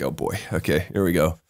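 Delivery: a low, soft, resigned aside under the breath; resigned dread. Retold or imitated. Retelling his dread as the boring friend swaps in, low and resigned. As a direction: sigh it out like you're bracing for a long night.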